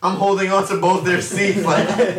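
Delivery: ordinary talk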